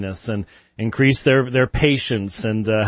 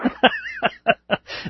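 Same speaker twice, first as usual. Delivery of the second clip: high, light giggling; high-pitched burst of laughter; amused laugh; 4 dB quieter than usual. Real, not acted. Laughs at caller's happy remark.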